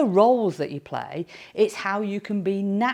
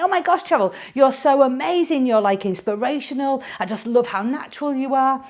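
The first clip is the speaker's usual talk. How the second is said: high-pitched breathless fan gushing; gushing admirer; 5 semitones higher, 4 dB louder than usual. Retold or imitated. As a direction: imitate an overexcited fan, pitched up. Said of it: "this woman come running up to me".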